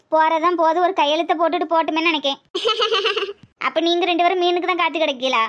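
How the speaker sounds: normal talking voice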